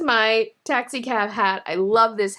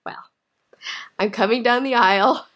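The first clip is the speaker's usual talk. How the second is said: ordinary talk, nothing marked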